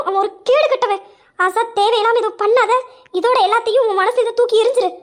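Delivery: heated, urgent warning; angry scolding. Acted. Friend scolding her for loving a scoundrel, angry. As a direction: lay into her, then press her to drop him.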